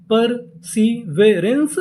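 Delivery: Perseverance stretched very slowly, rising; drawn-out model reading; very slow word. Shown as a demonstration. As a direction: say it very slowly for learners. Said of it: "पूरी meaning क्या बनी".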